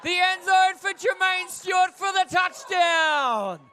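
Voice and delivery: high, excited play-by-play shout; touchdown call. Real, not acted. Commentator calls a touchdown pass, excited shout. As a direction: ring out the touchdown, stretching the finish.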